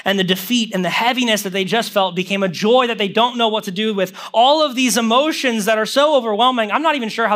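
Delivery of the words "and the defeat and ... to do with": ordinary delivery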